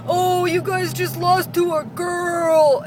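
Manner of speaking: high, slow, sing-song gamer taunt; mocking drawn-out jeer; taunting teenage boy. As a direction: imitate a gloating teen in the lobby. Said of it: "fifteen, and he's like".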